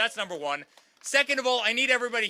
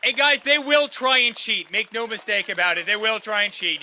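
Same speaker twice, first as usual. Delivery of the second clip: rally warning; 3 semitones higher, 7 dB louder than usual. Real, not acted. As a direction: drive the warning home to the crowd. Rally warning about cheating.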